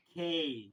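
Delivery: खे with the ए वाई vowel held long and loud; prolonged vowel. Shown as a demonstration. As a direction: stretch खे out. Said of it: "इसको prolong करना है".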